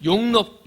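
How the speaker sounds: 용납 called out high and loud for the church to echo; 용납 said slowly and firmly, like a cue to repeat; word for the congregation to repeat. Shown as a demonstration. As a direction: lead the congregation in saying 용납. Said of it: "한번 따라합니다".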